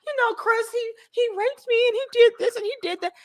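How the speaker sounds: high-pitched mock complaint; mock accuser. Woman accusing Chris of wronging her, mock high-pitched complaint.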